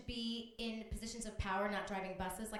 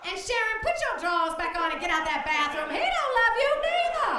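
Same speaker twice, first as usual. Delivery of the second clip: loud, much higher sassy scolding; Missus Washington; 7 semitones higher, 11 dB louder than usual. Retold or imitated. Bus driver on a plane scolding a hijacker, loud and sassy. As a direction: put the hijacker in his place, loudly. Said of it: "hear Missus Washington go".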